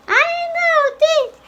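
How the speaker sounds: put-on very high girl puppet voice; high, eager girlish pupil; little girl character. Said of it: "I am the girl".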